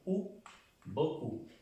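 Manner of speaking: normal talking voice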